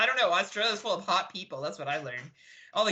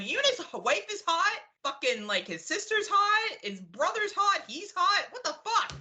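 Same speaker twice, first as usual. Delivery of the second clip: high-pitched, incredulous outburst; exasperated ranting; 11 semitones higher than usual. Real, not acted. Co-host griping about Tim's hot family, exasperated high rant. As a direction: rant in high-pitched disbelief, ticking off each one.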